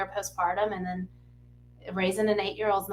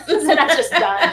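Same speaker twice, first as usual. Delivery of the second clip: ordinary delivery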